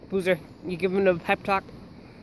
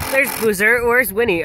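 excited spotting; 3 semitones higher, 6 dB louder than usual. Real, not acted. Calling out spotting dogs.

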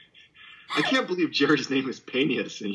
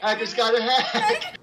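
laughing mimic; 12 semitones higher than usual. Real, not acted. Mocking echo, laughing.

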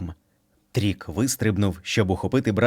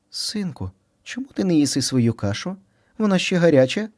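mother bunny; 8 semitones higher than usual. Acted. Mother asks son at dinner.

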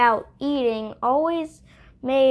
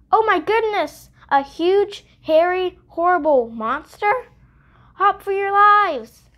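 higher and louder storybook alarm; panicked rabbit; 7 semitones higher, 5 dB louder than usual. Acted. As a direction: cry out for everyone to flee. Rabbit panics at monster news.

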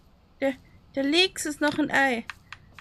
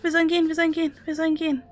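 rattled off fast; quick nervous repeat; rushed; 4 dB louder, much faster than usual. Real, not acted. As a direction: hurry the repeated words out. Hurried by the creature.

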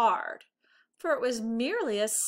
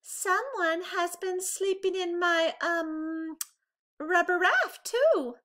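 higher, hesitant mother bear voice; Mama Bear adding; 9 semitones higher, 4 dB louder than usual. A narrator's reading. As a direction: fumble for the word like a nervous mama bear. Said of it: "added Mama".

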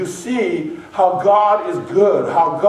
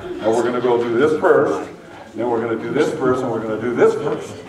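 lower, quick, bossy itinerary voice; mimicking the relative who plans every ride; organized planner; 8 semitones lower, faster than usual. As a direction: rattle off the plan like the family organizer. Organized family member planning the day.

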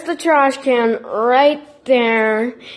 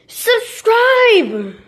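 shouting; 8 semitones higher than usual. Real, not acted. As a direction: yell it at the viewers, begging. Urging viewers to subscribe.

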